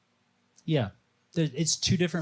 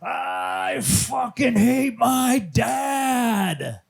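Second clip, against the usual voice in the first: very slow, high, loud drawn-out scream; imitated scream; 11 semitones higher, 5 dB louder, much slower than usual. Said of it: "this guy's screaming it over and over".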